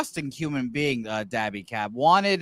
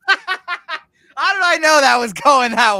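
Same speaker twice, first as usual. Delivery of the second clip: laughing through the words, higher and louder; 8 semitones higher, 6 dB louder, slower than usual. Real, not acted. Reacting to Dutch's joke, laughing. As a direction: crack up while asking.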